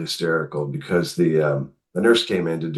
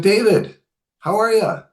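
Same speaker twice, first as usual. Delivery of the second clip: high-pitched, louder lively hello; imitating mom's greeting; 5 dB louder than usual. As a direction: act out mom suddenly calling out a greeting. Voicing mom's unexpectedly lively greeting.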